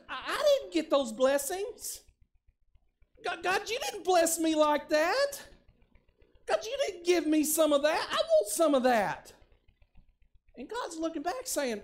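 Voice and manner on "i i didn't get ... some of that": whiny complaint. Acted. Believer whining to God.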